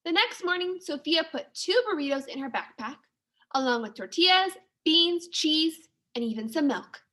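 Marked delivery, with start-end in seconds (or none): none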